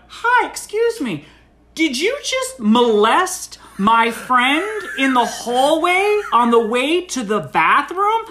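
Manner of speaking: high, loud, slow mock accusation; public confrontation. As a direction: spell the accusation out for the whole room. Publicly confronts woman at party.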